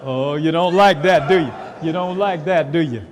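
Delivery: loud, high teasing jab; playful loud teasing. Real, not acted. Preacher teasing a congregant, loud and playful.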